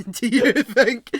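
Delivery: loud, laughing mock disbelief; teasing through laughter. Real, not acted. Co-host teasing his friend about growing up, laughing loudly. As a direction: rib your friend while cracking up. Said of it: "I was like".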